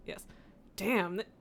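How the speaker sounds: ordinary talk, nothing marked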